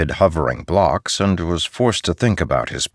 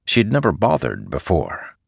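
ordinary delivery

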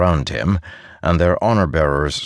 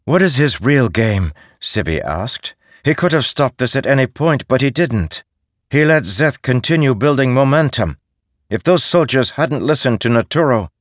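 higher, louder, urgent Sibi; agitated council member; 8 semitones higher, 4 dB louder than usual. Acted. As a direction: press the point, alarmed and higher. Sibi argues heatedly.

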